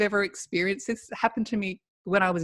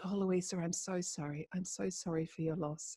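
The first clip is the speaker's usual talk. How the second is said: softly consoling, much softer; hushed condolence; 15 dB quieter than usual. Retold or imitated. Family member after the funeral offering condolences, hushed and gentle. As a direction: murmur the sympathy gently and quietly. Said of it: "she said".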